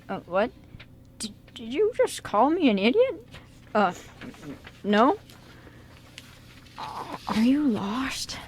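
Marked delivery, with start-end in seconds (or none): 1.2-3.2 put-on higher, indignant old woman; offended old lady. 6.8-8.4 put-on old lady.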